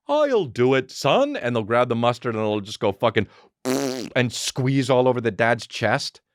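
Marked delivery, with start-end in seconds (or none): 0.1-1.4 higher, mocking dad voice rising on the end; dumb cartoon dad. Cartoon dad offers to help son, mock dad voice. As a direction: play a clueless show dad. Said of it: "the dad's like".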